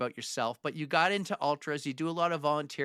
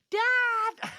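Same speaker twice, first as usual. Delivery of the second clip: put-on high, loud drawn-out kid's yell; child yelling; 5 dB louder than usual. Son yelling for dad through the open door, imitated high holler. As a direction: holler it high like a kid summoning a parent. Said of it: "him yelling".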